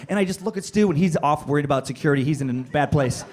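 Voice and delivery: usual speaking voice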